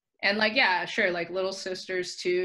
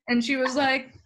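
usual speaking voice

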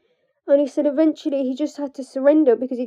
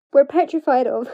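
normal talking voice

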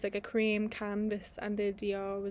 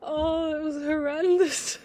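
high, loud, helpless laughter; crying with laughter at the memory; laughing; 7 semitones higher, 6 dB louder than usual. Real, not acted. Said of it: "I can't stop laughing".

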